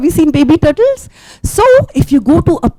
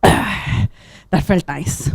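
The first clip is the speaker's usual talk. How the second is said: satisfied sigh; 7 semitones lower, 5 dB quieter, much slower than usual. Acted. Dog enjoys the fish.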